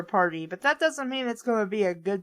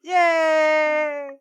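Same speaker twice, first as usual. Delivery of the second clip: cheer; 3 semitones higher, 4 dB louder than usual. Acted. Cheer after the song.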